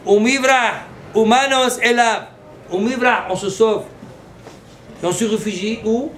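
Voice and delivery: loud, high preaching at full force. Acted. Rabbi preaching fear of God, loud and fervent. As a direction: thunder out fear and refuge.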